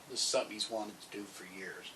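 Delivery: ordinary talk, nothing marked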